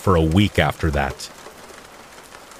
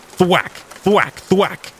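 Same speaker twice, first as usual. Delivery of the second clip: cane thwacks; 10 semitones higher, much slower than usual. A narrator's reading. Old man hits kids with cane.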